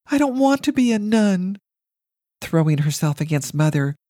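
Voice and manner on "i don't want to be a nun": put-on high, loud pleading child; child's cry. A narrator's reading. Girl pleading with mother she doesn't want to be a nun, high and loud. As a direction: beg like a frightened little girl. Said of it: "she cried".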